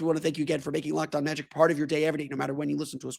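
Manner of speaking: ordinary talk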